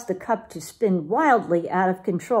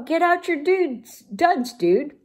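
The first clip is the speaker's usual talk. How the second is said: higher, urgent Don Diego; 8 semitones higher, slower than usual. Acted. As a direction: hurry the pups along. Don Diego urges the dogs.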